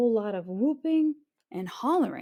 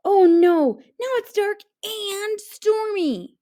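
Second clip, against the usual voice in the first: frightened; 12 semitones higher, 8 dB louder than usual. A narrator's reading. Tim frightened by noise.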